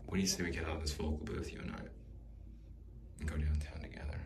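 quick hushed come-on that sinks into a whisper; mock seductive murmur. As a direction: sweet-talk her softly, rushing the ask. Asking Shirley out, mock seduction.

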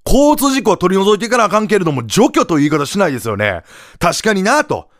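usual speaking voice

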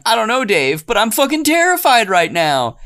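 high, panicked mock fright; mock terrified. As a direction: squeal it like a terrified monster sister. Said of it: "the monster older sister is saying".

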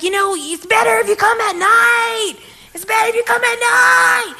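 very high, loud, stretched-out holler; imitated yelling. Railroad worker calling advice to visitors, yelling. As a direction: mimic a pestering heckler's high shout. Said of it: "they were, like, yelling".

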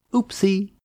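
high, sing-song sarcastic oopsie; mock oopsie. Narrator mocking the bank's misconduct, with a sarcastic, high oopsie. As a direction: toss it off with mock innocence. Sarcastic aside on bank scandal.

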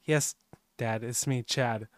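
a hint of a spooky son appearing; low calm son voice. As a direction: say it low and soft like an eerie returned son. Son poofs into kitchen.

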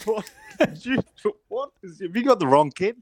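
put-on a parent sure the school has the wrong kid; high-pitched amused disbelief; incredulous laughing disbelief. Parents shocked reading school letter.

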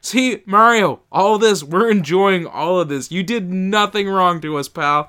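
loud, swaggering tough guy; gangster voice. Acted. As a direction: boom it out like a mobster pal. Acted pirate thanking Mario.

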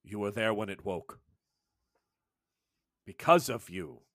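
lightly voiced elder, foreboding; ominous elder. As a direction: let each line hang like a warning. Sectu speaks ominously to Faye.

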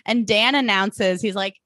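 ordinary talk, nothing marked

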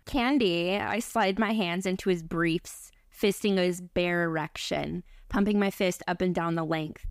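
ordinary delivery

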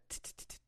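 soft ticking taser noise; imitated taser crackle. Threatening to tase the tough-talking owner, soft imitated taser clicks. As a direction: make a quiet stuttering zap with the tongue.

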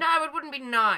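high, loud insistence; mock appalled. Host rejecting brain freeze torture, loud mock appalled. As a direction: agree in loud exaggerated disapproval.

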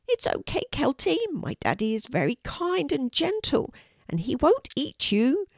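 high, small comforting voice; reassuring little dragon. A narrator's reading. Norman calms scared Kelty, reassuring little dragon. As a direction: soothe in a high childlike voice.

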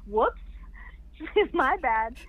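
giggling through a mock apology; laughing oops. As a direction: laugh it off, sheepish. Laughing at bumping belly.

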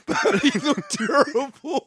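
laughing. Real, not acted. Laughing at tired teammate.

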